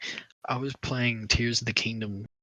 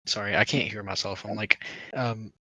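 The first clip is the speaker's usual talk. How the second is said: normal talking voice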